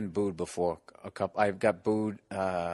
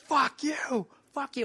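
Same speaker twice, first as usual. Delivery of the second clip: very high mock scream, volume held back; high, squeezed heckler yell; imitated heckler scream; slower than usual. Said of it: "he's screaming".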